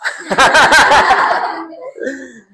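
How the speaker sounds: loud, high burst of laughter. Real, not acted. Teacher laughing at her own urge to shout, loud laugh.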